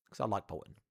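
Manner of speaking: ordinary delivery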